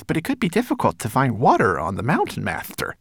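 high, fast, lisping servant voice; worried servant. A narrator's reading. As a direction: object hurriedly to your master. Servant timidly objects to master.

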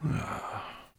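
put-on soft breathed-out old dragon sigh; long-gone memory sighed out softly. A narrator's reading. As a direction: let out a quiet, weary "ah". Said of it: "He sighed".

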